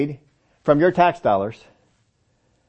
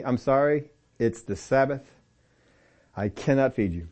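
mock apology; 3 semitones lower, 6 dB quieter than usual. Mock excuse to a hungry dog.

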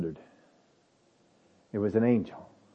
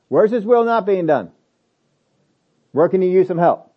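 ordinary talk

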